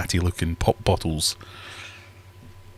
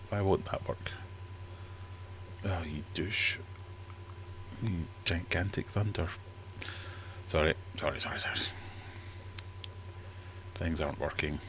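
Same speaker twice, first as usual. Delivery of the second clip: muttering to oneself; 2 semitones lower, 15 dB quieter than usual. Real, not acted. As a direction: grumble under your breath at misbehaving tech. Muttering at uncooperative tech while prepping quiz.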